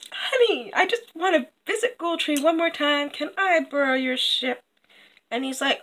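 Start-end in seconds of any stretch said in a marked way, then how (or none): 0.1-4.6 high, sweet-talking villainess; wheedling wife. Acted. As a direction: coax your husband in a raised, innocent voice. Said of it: "and she's like".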